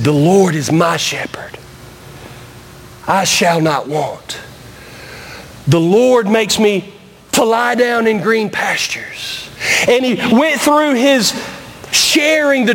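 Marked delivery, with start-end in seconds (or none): 0.0-9.5 put-on low, slow, hushed old preacher reciting; raspy old preacher. Old preacher living the psalm to the crowd, low and slow. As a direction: breathe each line out like an old preacher living it. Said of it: "very raspy voice from years of preaching".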